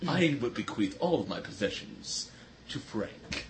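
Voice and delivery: low, slow dramatic trailer narration; movie trailer voice. Retold or imitated. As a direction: announce it like an epic movie trailer. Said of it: "The movie announcer guy".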